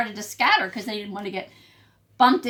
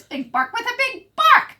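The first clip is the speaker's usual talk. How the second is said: excited reveal; 14 semitones higher, 9 dB louder than usual. A narrator's reading. Big bark reveal.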